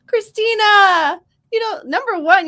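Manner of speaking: drawn-out, loud, high exclamation of her name; mock scolding call. Real, not acted. Host chides a viewer for self-criticism, loud protest.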